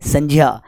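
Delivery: "संज्ञा" said as "sanjiya"; "संज्ञा" with j-y, falling at the end; correct pronunciation. Shown as a demonstration. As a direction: say "संज्ञा" with j in the middle. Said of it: "क्या है ये?".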